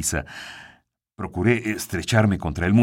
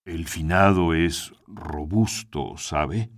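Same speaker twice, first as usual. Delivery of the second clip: creepy butler; 3 semitones lower, slower than usual. A narrator's reading. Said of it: "Escuché de nuevo su voz cavernosa".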